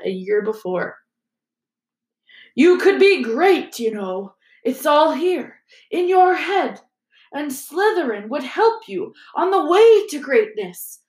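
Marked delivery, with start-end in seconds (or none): 2.6-11.0 higher, slow coaxing voice; Sorting Hat voice. Acted. Sorting Hat's small voice.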